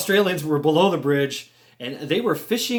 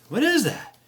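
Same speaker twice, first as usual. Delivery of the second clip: puzzled surprise; 8 semitones higher than usual. Retold or imitated. Recalls puzzled reaction to thing approaching.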